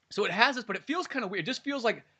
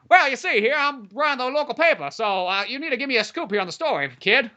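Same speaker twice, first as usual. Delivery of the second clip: high-pitched old-time reporter impression; 7 semitones higher than usual. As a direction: parody a scoop-chasing local newspaperman, pitched up. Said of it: "And they give him this voice".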